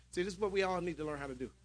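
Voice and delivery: usual speaking voice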